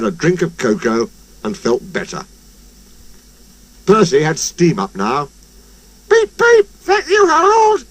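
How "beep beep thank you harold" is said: high, loud whistling engine voice; high cheerful engine; whistled thanks. A narrator's reading. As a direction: toot it out happily. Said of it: "he whistled".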